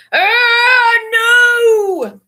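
high, loud frightened cry; terrified cry. A narrator's reading. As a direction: cry out in sudden terror. Dream ends in a cry.

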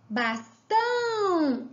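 "Bastão" slow, TÃO stretched long; stress on TÃO. Shown as a demonstration. Teacher showing the tonic syllable, TÃO held long in "Bastão". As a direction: draw out the end of "Bastão". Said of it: "é o tão".

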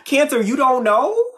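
high, loud "you don't know?" rising; mock disbelief. Teasing Cancer viewers, mock disbelief. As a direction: tease them in disbelief.

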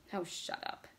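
soft, dull voice with no push; dull, forceless. A narrator's reading. Bert answering Vicky's outburst, dull and without force. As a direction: say it without force, dropped well down. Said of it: "his voice was dull and without force".

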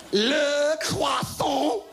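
very slow, high, drawn-out French "Le croissant"; French accent. Retold or imitated. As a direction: stretch "Le croissant" like a snooty Frenchman. Mocking French pronunciation.

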